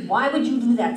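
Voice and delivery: loud demand. Acted. Thor demanding of the boy.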